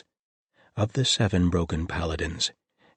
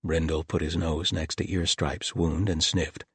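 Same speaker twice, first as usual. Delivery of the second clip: plain reading voice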